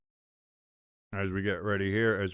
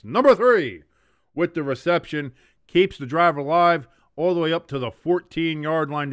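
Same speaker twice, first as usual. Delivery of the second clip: louder, higher rising call; excited play-by-play; 5 semitones higher, 10 dB louder than usual. Real, not acted. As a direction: sell the big catch. Big completion on third down.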